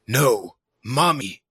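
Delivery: slow, flat robotic whine; monotone robot villain voice; emotionless robot. Retold or imitated. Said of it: "evil robotic voice".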